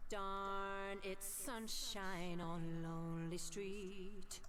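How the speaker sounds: sung slowly with heavy echo; slow sung line drenched in echo; echo effect. Shown as a demonstration. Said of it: "And this is the echo".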